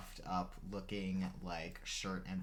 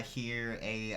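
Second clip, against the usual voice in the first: ordinary talk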